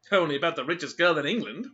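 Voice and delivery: a touch of cheerful brightness. Acted. Tim telling Rosalie about the heiress, mildly cheerful. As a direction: toss it off lightly. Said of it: "replied Tim cheerfully".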